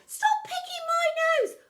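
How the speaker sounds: very high, loud protest; a child yelping in the crush; squeaky complaint. A narrator's reading. Pupil crushed in the room, very high yelp.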